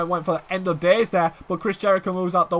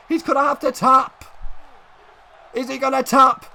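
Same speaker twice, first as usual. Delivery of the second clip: high, urgent wrestling commentary; excited play-by-play; 5 semitones higher than usual. Real, not acted. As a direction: keep the pitch up through the submission drama. Submission hold, then escape.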